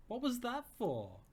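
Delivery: a mock-offended friend; Emily protesting. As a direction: sound put out but playful. Emily protests after being scared.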